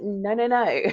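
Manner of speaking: no no no through a laugh; laughing mock refusal; laughing protest. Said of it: "which I'm just like".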